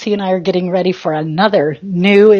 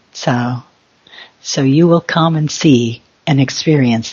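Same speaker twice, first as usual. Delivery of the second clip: channeled ancients voice; 8 semitones lower than usual. Acted. Channeling the ancients to announce the gathering, low and solemn. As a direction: speak as the ancients, deep and calm.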